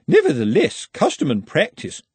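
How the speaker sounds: stern housekeeper, high and firm; prim housekeeper standing on rules; severe housekeeper. A narrator's reading.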